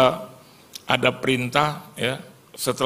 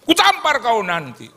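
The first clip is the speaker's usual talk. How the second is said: much higher, louder angry snap; startled threat; 11 semitones higher, 4 dB louder, slower than usual. Retold or imitated. Splashed man threatens the car, loud high shout. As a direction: threaten the driver.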